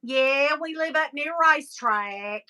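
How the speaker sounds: a gushing Southern greeting, laid on thick; mock Southern accent. Retelling greeting Tennessee locals, mock Southern accent. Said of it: "We was like".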